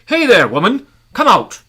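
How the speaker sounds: loud, high call at the door; shouted. Acted. Man calling at the woman's door, loud shout. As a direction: shout her out of the house.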